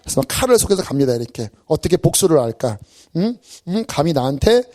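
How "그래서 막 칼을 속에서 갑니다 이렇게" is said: usual speaking voice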